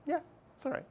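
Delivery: high, soft mock shrug; breezy falsetto. Teacher marking the quiz answer right, high and airy. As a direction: toss it off lightly in a high voice. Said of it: "I just went".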